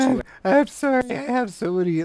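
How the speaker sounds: real laughter breaking into the apology; high and loud through giggles; can't stop laughing mid-sentence. Real, not acted. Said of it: "and I'm just laughing at it".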